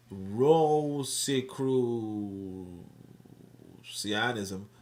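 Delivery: very slow, drawn-out syllables; sounding out. Real, not acted. Host reads an unfamiliar term from screen, sounding it out. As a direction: stretch the word out while working out how to read it.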